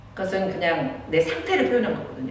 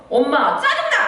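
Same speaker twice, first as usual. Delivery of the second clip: louder, higher mock outburst; irritated child; 9 semitones higher, 6 dB louder than usual. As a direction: snap at your mom like a cranky kid. Child snaps at mother. (엄마에게 짜증 내는 아이, 크고 높게)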